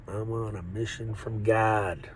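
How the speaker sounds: normal talking voice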